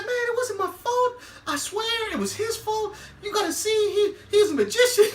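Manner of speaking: high-pitched pleading excuse, well above his own voice. Retold or imitated. Barber to the angry girl, a high whiny excuse. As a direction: whine the excuse up high like a caught kid. Baby defending himself, voiced quote.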